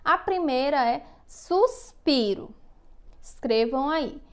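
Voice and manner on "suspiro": slow, drawn-out SUSPIRO; stretched-out classroom dictation of suspiro. Shown as a demonstration. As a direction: dictate "suspiro" slowly for pupils to write. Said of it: "eu vou ditar algumas palavrinhas".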